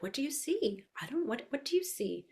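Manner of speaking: soft, high questioning of toddlers; softly puzzled preschool teacher; animated teacher voice. Shown as a demonstration. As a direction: prompt small children gently and curiously. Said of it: "So this is my animated voice".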